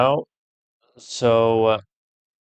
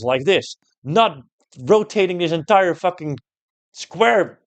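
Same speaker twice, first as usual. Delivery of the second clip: higher, heated ranting at the puzzle design; angry rant; 6 semitones higher than usual. Real, not acted. Streamer ranting at the game's unfair puzzle, heated and higher. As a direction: vent the frustration, pushing each curse. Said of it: "that pisses me off".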